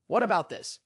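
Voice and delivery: plain reading voice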